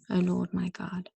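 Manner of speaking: a devotional poem, each line set apart; reading her own prayer to the Lord, earnest and devotional. Acted. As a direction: read aloud as a heartfelt prayer to God. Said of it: "I'm just gonna read it to you".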